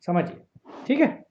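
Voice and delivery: usual speaking voice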